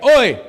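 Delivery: "Oi!" shouted high and loud like a toast; booming Oi. Shown as a demonstration. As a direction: bark "Oi!" like raising a beer stein. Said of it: "sounds like a German lifting a stein".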